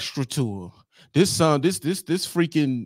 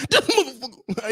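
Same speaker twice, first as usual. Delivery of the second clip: imitating Dave, laughing through the words, a touch higher; laughing disbelief; 7 semitones higher than usual. Dave cracking up at the truth-telling, laughing. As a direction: say it mid-laugh, caught off guard. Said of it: "Dave laughing like".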